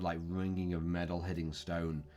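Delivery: ordinary talk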